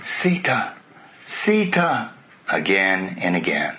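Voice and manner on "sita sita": calling her name, rising higher on the second; a desperate, lightly voiced cry into the forest; long, high, helpless call of a name; crying out helplessly. A narrator's reading. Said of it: "Ram cried out helplessly".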